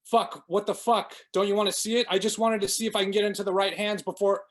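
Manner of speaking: high, loud, fast panicked babble; panicked hyperventilating. A narrator's reading. Tyler panicking after the knife hits the keyboard, loud panicked babble. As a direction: gasp it out in panic. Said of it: "Tyler hyperventilated".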